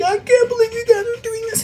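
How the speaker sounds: high panicked whining voice; shrill mock victim voice; guy in distress. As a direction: squeal it like a man being chased. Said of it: "he's like".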